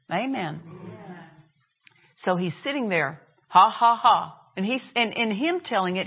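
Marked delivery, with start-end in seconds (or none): 3.5-4.3 loud deliberate ha ha ha; inspired laugh. Retold or imitated. Man in bed laughs at the devil, loud defiant laugh. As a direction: laugh in the face of trouble. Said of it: "he says it sounds just that inspired".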